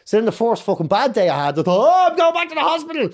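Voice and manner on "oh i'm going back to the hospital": high, loud laughing mock alarm; a hammed-up, laughing cry of alarm; laughing mock panic.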